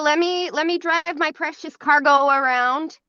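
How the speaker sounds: ordinary delivery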